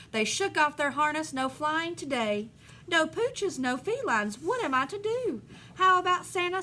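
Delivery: lively storybook read-aloud; reading to children. Acted. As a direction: read the rhyme to the class with bounce. Teacher reads picture book aloud.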